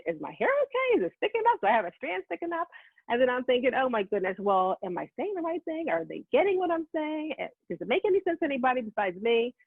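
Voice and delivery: high-pitched, self-conscious worrying; imitated panicky inner voice, question piled on question; mock fretting. Acting out her anxious thoughts.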